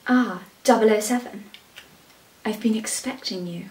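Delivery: hammed-up villain with pauses; Bond villain. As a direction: greet the spy like a waiting villain. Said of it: "You be the villain".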